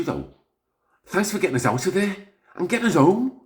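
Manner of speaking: ordinary talk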